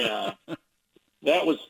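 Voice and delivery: usual speaking voice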